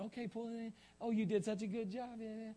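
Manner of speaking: put-on high, cooing praise to a small child; doting parent. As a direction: coo it high, like helping a toddler. Parent cheering child tying shoes.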